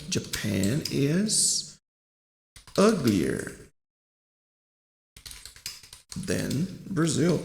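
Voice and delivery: stretched words with long pauses; slow example sentence. Shown as a demonstration. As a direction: build the sentence slowly, piece by piece. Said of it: "eu posso falar aqui". (frase de exemplo lenta, com pausas longas)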